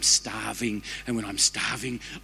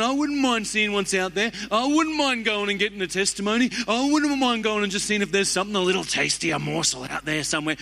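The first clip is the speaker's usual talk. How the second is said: wanderer's inner voice; 8 semitones higher than usual. Acted. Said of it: "you're thinking".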